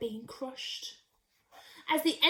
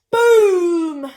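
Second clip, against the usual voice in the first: loud boom; 4 semitones higher, 13 dB louder than usual. A narrator's reading. Asteroid hits the rocket.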